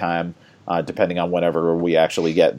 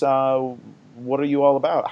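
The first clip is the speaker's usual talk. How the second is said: ordinary delivery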